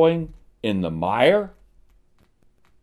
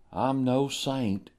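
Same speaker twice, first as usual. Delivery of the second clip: mock humble sinner; 3 semitones lower, 13 dB quieter, slower than usual. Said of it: "I've heard him say".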